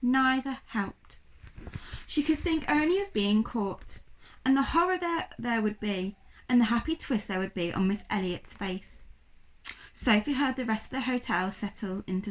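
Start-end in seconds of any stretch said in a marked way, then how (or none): none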